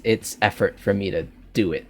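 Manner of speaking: ordinary delivery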